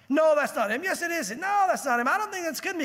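high-pitched back-and-forth squabble; bickering bystanders. Acted. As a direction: argue it to and fro in mock dispute. Acted bystanders arguing back and forth.

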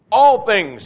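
All things called out loud and high, all hit hard; exclaimed. Shown as a demonstration. Preacher making Paul's exclamation point heard, loud and high. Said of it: "like the exclamation point".

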